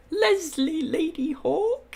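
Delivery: high, slow, drawn-out nickname; playful echo. Acted. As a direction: repeat it in an amused high voice. Repeats the viewer's playful nickname.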